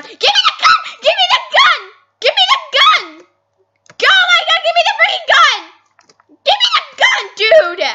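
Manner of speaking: very high, loud screaming demand; frantic yelling. Real, not acted. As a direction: scream for the gun.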